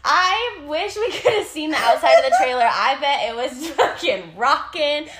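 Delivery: loud, laughing retelling; laughing hard. Real, not acted. Storyteller recalling the trailer losing it, loud and laughing. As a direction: belt it out through the laughter.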